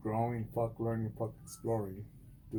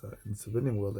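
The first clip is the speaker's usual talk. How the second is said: ordinary delivery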